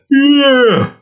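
mock Joe yeah; Joe Swanson yeah. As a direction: fire off a cartoon yeah. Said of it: "I'll probably do the".

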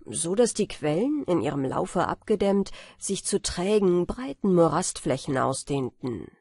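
ordinary delivery, nothing marked

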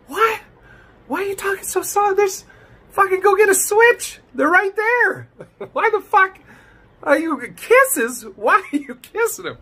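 high, incredulous squawk of a disbelieving dad; mocking the father. Reactor mimics the father scolding grandma, high disbelieving squawk. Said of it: "he's like".

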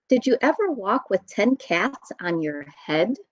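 plain reading voice

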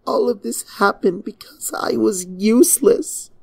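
high, broken whisper into her hooves; hushed despairing princess; anguished whisper. Acted. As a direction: whisper it like a confession you can't bear.

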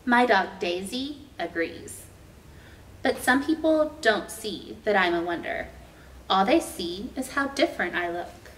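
ordinary delivery

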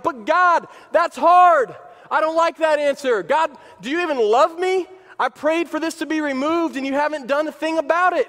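high, loud whining protest; acted indignant plea; protesting complaint.

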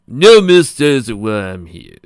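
loud, drawn-out charmer's line; big, slow charming delivery; turning on charm. A narrator's reading. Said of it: "turning on the charm".

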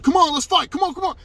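high, yelled egging-on; loud, imitated aggressor. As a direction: imitate him daring someone to fight. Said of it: "this is me imitating him".

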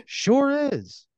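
very high, loud chirp in character; Kevin Heaven. Acted. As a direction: put on a squeaky-high voice and agree. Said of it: "And Kevin's like".

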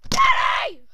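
put-on high, piercing child's shout; yelling for Daddy. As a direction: scream for your dad from another room.

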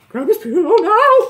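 insistent high figment voice; urgent eerie command. Acted. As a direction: urge them on like an insistent phantom. Figment urges the hesitant Bonnie on.

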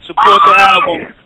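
shouted sign-off. Real, not acted. Signing off loudly to fans.

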